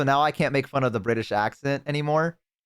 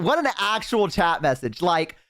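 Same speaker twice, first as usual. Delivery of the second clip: ordinary talk, nothing marked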